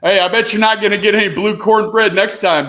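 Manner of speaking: loud playful jab; loud teasing. Real, not acted. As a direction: shout it as a joke. Teasing shout at friend.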